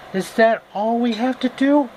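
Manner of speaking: put-on high, girlish question; girl's voice. Dorothy questioning the Wizard, high and girlish. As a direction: ask it up high like a young girl.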